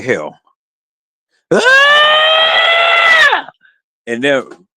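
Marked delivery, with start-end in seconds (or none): 1.5-3.5 high piercing mock shriek, louder; sudden imitated scream. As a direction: shriek like someone bolting out of a room. Mimics her bolting out screaming.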